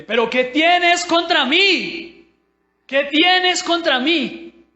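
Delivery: high, full-voiced shout with drawn-out words; slow, loud acted scream of accusation; acted yelling. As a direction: yell it like the possessed man confronting Jesus. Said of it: "imagínese cómo estaba gritándole".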